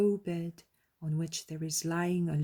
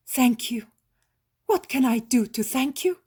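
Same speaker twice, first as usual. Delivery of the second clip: put-on old woman voice; 7 semitones higher, 9 dB louder than usual. A narrator's reading.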